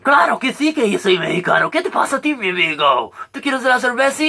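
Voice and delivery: loud, very high overdone Mexican voice; hammed-up Mexican accent. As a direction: belt it like a cartoon Mexican host. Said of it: "dress up in a silly costume like how I just did".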